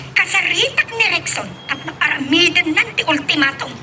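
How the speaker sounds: usual speaking voice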